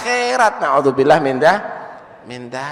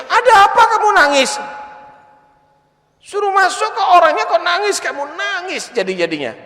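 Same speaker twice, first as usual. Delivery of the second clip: high, fast, alarmed questioning; startled husband; 14 semitones higher, 4 dB louder than usual. Acted. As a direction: ask high and fast, startled by her tears. Husband startled by wife crying. (suami kaget bertanya kenapa istrinya menangis, tinggi dan cepat)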